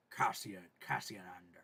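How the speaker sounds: low breathy whisper in an Irish lilt; a gruff Liam Neeson murmur; lightly imitated Irish gravel. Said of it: "Liam Neeson type".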